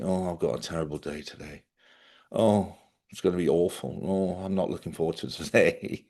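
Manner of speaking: hammed-up moping over a bad day; mock gloomy moaner. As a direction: sigh it out like a self-pitying grump. Mocking people who take themselves seriously.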